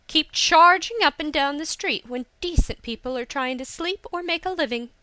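exasperated rebuke; indignant scolding. A narrator's reading. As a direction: tell him off for disturbing decent people. Hisa scolds the landowner's son.

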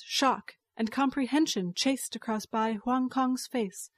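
ordinary delivery

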